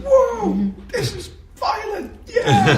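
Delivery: high-pitched whoop of an excited audience; mock cheer with a big high "whoa"; cheering crowd. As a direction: whoop it up, laughing, as a thrilled crowd. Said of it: "They're like".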